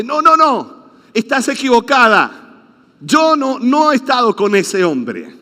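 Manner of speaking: Peter's loud scared denial; panicked denial. Acted. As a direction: deny it loudly and fast, cornered.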